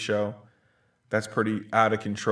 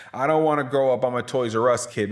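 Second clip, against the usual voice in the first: defiant shout; 3 semitones higher, 6 dB louder than usual. Acted. Defiant childish chant.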